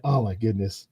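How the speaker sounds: ordinary delivery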